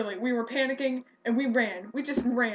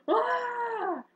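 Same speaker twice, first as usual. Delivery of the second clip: scream; 12 semitones higher than usual. Retold or imitated. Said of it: "we were just like".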